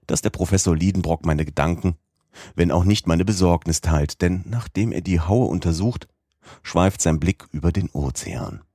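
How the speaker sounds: ordinary delivery, nothing marked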